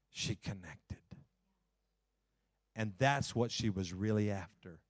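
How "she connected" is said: breathed-out hushed whisper. Real, not acted. Preacher telling Hannah's moment with God, whispered. Climax of the story.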